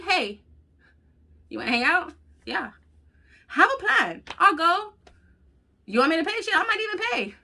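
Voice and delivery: high, singsong easygoing voice; lightly voiced carefree self; mock breezy self. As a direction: play up how chill you are. Mocks her easygoing self.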